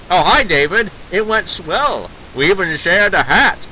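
put-on old movie mid-Atlantic chatter; mid-Atlantic accent. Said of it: "whip out that mid-Atlantic accent".

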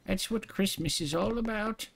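high mock cheer. Mock cheerful Christmas line.

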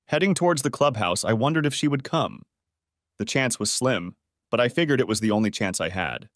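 ordinary delivery

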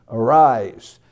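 loud ringing command; the Lord lifts Sarvabhauma with a loud commanding call; commanding roar. Acted. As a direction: boom it out like a divine order. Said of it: "continued to roar loudly".

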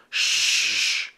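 soft hushing shh; quiet shushing. A narrator's reading. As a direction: hush them quickly. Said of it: "shushed Howler".

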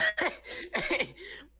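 high laugh. Real, not acted. Laughing at roast.

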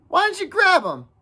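put-on high-pitched boyish reproach; exasperated challenge. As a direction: demand to know why. Jack challenges Ralph over the boar.

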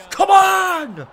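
high, loud shout of frustration; frustrated yell. Real, not acted. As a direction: yell it at the screen, fed up. Frustrated player yelling at game.

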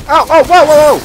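high, loud rapid cries; alarmed whoas. Real, not acted. Player caught by a sudden attack, loud alarm.

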